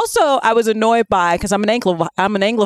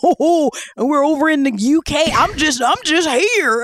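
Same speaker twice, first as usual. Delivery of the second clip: put-on higher, slow bumpkin accent; country bumpkin; 5 semitones higher than usual. Mimicking the actor over in the UK, bumpkin drawl. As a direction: drag it out as a clueless country bumpkin. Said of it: "doing a country bumpkin".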